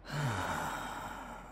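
soft, satisfied sigh; contented sigh. Acted. Villain sated beside lover, soft contented sigh. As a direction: let out a long sated breath.

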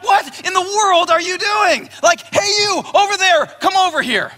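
scolding elders shouting at a man carrying his bed; calling out to the man. Retold or imitated. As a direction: hail him sharply from across the street. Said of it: "they call out to him".

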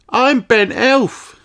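nervous. A narrator's reading. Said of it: "says Ben nervously".

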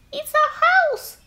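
very high squeaky mouse voice; squeaking mouse. Acted. Mother Mouse squeaking to George about her burnt house, very high. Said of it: "squeaked Mother Mouse".

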